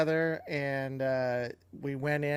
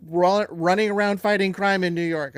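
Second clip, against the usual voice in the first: indignant rant; 4 semitones higher, 6 dB louder than usual. Real, not acted. Venting about the forced change.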